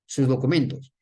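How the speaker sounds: low, firm police-style demand; firm authority. Acted. Policeman asking a driver for documents, firm. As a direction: state it low and firm, without getting upset. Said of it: "es firme ... el tono de voz".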